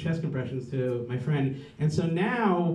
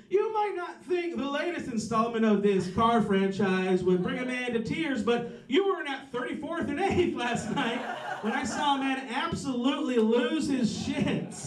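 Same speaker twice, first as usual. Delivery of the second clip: mock film critic; 7 semitones higher than usual. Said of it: "a review somewhere that's like".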